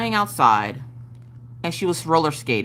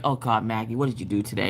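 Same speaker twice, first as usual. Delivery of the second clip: husband voice; 9 semitones lower, 4 dB quieter than usual. Retold or imitated. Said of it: "he's like".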